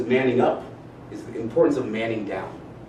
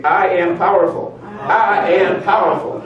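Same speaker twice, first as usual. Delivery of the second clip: louder, strong declaration; proud affirmation; 6 semitones higher, 5 dB louder than usual. Shown as a demonstration. Leading the group affirmation, proud and loud. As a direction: say it like you own it. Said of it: "and you say".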